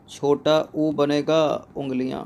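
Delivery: छोटा ऊ बनेगा उंगलियां, each word drawn out; slow spelling demonstration. Shown as a demonstration. Teacher showing students the short vowel in उंगलियां, slow demonstration.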